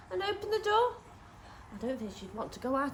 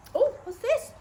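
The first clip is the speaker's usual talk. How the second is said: much higher, louder oh; surprised; 12 semitones higher, 7 dB louder than usual. Acted. Reader spotting the magpie for the children, high and loud. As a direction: gasp at something new.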